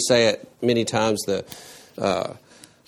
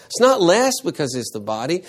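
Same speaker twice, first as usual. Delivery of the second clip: ordinary talk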